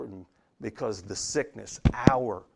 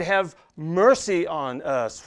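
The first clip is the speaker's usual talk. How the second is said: crying out for mercy; 5 semitones higher, 11 dB louder, slower than usual. A narrator's reading. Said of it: "And they cried".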